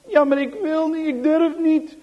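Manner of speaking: high, loud fearful wail; scared refusal. Acted. Johan refusing the cupboard, high fearful wail. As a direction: wail it, too afraid.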